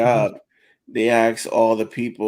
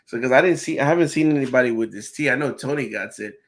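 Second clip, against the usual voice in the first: ordinary talk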